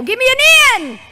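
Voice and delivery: high loud cheer call; cheerleader shout. Acted. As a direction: belt the letter out. Leading a cheer chant.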